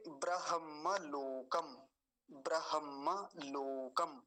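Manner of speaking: ब्रह्मलोकम slowly as bra-ham-ma-lo-kam; careful pronunciation. Shown as a demonstration. Teacher presenting the next word, careful pronunciation. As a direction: stretch each syllable of ब्रह्मलोकम. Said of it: "अगला शब्द देखिए".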